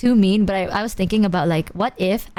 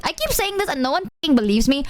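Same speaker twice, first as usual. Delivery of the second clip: normal talking voice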